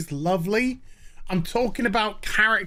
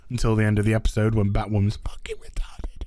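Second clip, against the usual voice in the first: a fast, lower, unvoiced aside; whispered aside; 11 semitones lower, faster than usual. Real, not acted. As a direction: slip it in under the breath. Muttered aside.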